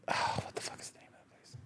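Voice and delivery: unvoiced whispered aside to herself; whispered self-question. Real, not acted. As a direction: mutter it under your breath while searching. Muttering to self, searching memory.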